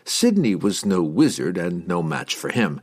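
usual speaking voice

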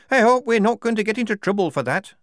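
a recruit fretting about trouble; nervous Shufti. Acted. Shufti worries after the parley.

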